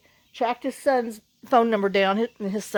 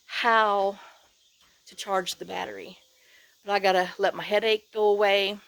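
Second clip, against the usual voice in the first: ordinary delivery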